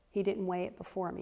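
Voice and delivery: normal talking voice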